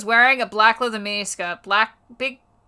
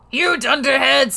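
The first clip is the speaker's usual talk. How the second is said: screaming; 4 semitones higher, 4 dB louder than usual. Acted.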